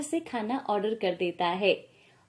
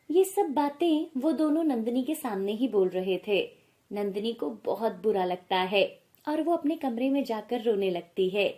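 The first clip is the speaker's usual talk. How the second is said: usual speaking voice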